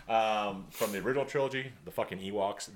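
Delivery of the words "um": ordinary talk, nothing marked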